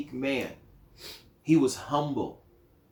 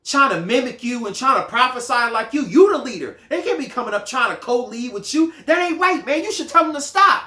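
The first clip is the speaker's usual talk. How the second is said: higher, louder, worked-up street talk as Joshua; indignant Joshua; 7 semitones higher, 4 dB louder than usual. Retold or imitated. Joshua urging Moses to stop the prophets, indignant. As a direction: egg Moses on to shut them down. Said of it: "That was Joshua's mentality".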